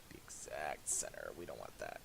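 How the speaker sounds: ordinary talk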